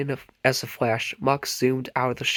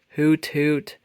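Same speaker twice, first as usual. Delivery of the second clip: owl hoot; 3 semitones higher, 3 dB louder than usual. A narrator's reading. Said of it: "they heard a strange noise".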